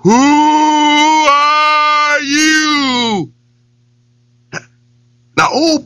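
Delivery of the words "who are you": drawn-out distant echo of the shout. Acted. Echo answers the lion from the well, hollow. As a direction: let it ring back like a well echo. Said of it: "his echo came back up".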